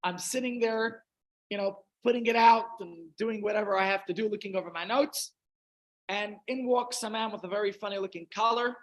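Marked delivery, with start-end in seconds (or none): none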